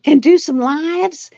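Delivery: a hint of the excited friend dreaming big; eager friend. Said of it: "she's like".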